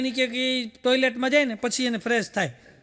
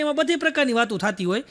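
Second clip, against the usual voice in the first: normal talking voice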